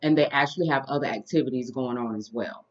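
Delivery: ordinary talk, nothing marked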